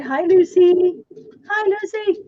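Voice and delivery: high sing-song hello to the pet; pet talk. Real, not acted. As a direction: coo a greeting to the dog. Greeting the pet.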